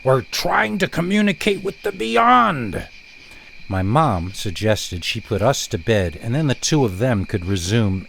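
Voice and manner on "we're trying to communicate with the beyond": high, strained exasperation; a father at the end of his patience; dad pained at his giggling kids. Retold or imitated. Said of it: "he uttered as he stared upward in pain".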